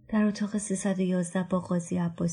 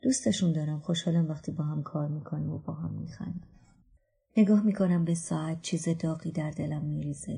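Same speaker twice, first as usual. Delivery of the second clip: usual speaking voice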